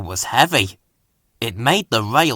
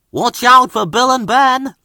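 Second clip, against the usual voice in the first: high, louder engine voice giving a friendly warning; Thomas warning; 12 semitones higher, 5 dB louder than usual. A narrator's reading. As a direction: warn him like a kindly little engine. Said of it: "Thomas warned".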